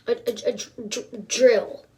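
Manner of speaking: hammed-up disbelieving stammer; broken repeats landing loudly on the last word; stunned stammering. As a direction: stutter on the word as if it will not compute. Acted stunned reaction to drill.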